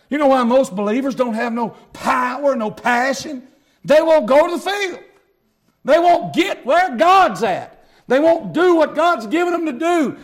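loud, fervent preacher build; preaching. Acted. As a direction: preach it to the back pew. Preaching at full volume.

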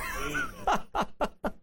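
high, quick burst of laughter; real high-pitched chuckle in separate bursts. Real, not acted. Preacher to congregation after praising God they're not there, laughing.

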